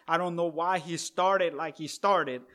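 ordinary talk, nothing marked

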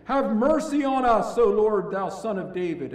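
loud, high plea for mercy; crying out. Acted. Blind men calling to passing Jesus, loud crying plea. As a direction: call out desperately across the road. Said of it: "cried out".

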